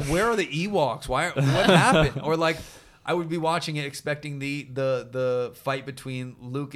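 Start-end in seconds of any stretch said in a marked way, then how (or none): 0.0-2.2 put-on loud, high, whiny kid asking where the Ewoks are; confused kid voice. A kid baffled that the Ewoks are missing, whiny and loud. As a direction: play your seven-year-old self, baffled.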